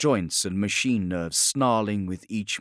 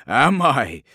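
laughing, louder and higher; mocking laugh; 5 semitones higher, 8 dB louder than usual.

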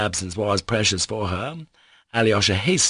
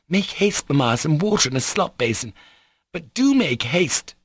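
high, agitated plea to hurry; fretful command, stretching the last plea; nervous urging; 8 semitones higher than usual. Acted. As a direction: hurry Mamma along anxiously. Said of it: "she finished nervously".

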